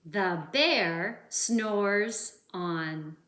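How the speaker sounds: drawn out, very slow refrain. Acted. Reader repeating the refrain for kids, slow. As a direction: stretch each word sleepily. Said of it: "remember this part?".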